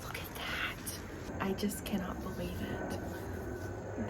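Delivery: slow, soft whisper of disbelief; awed whisper. Real, not acted. Whispering in awe over the bound volume. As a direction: breathe it out slowly in awe.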